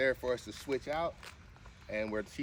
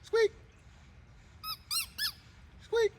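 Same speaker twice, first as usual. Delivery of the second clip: squeaky high command; slower than usual. Shown as a demonstration. Owner coaxing her dog to squeak, very high and squeaky. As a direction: squeak the word like a dog toy. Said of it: "You wanna squeak over there?".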